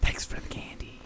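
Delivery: whisper. Real, not acted. Whisper aside.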